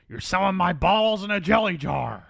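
high, loud quoted yell; mock movie outburst. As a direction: belt it out like an outraged movie character. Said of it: "Harrison describes this as".

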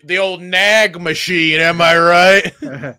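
put-on milk the old nag joke; mock comedian. Husband joking about his wife to friends, slow hammed-up punchline.

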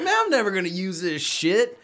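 loud and high, laughing through it; mock his own earlier words while laughing; laughing self-mockery. Laughing at himself for swearing he'd never use it. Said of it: "I've just been sitting here like".

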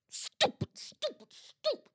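very high, punched little squeaks; silly squeak. Acted. Ranting about the Las Vegas Raiders, squeaky scorn. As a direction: squeak each word out separately.

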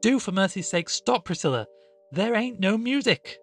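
high, loud, pleading rebuke; sharp exasperation. A narrator's reading. Woman orders her sister to drop it, sharply exasperated. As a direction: beg her sharply to stop. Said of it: "cried the other sharply".

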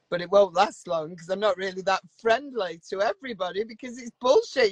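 ordinary delivery

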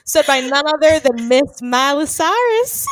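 slow, loud, high showy intro; mock announcer. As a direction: announce her like a gala host.

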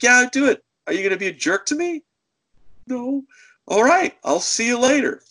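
high, punchy retold comeback; bossy challenge. Clerk making the caller behave, bossy. Clerk closing the quarrel, acted.

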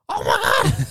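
high, loud mock squeal; mock excited shriek. Podcaster imitating the reaction to an engagement, mock excited shriek. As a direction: imitate an over-the-top excited scream.

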